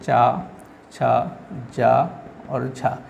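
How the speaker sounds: "चा, छा, जा और झा" slowly, syllable by syllable; sound demonstration. Shown as a demonstration. Teacher lists four affricates for the class, slowly sounding each out. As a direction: space out "चा, छा, जा और झा" with pauses. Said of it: "जिनको हम बोलते हैं".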